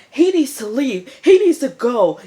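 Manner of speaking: mock panicked order; alarmed demand. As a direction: cry out that he has to get out. Girl says Pope must leave.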